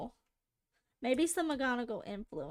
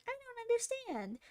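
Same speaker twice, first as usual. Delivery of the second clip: doing the bit; 10 semitones higher than usual. Retold or imitated. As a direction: repeat the meme line up high. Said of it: "where I'm like".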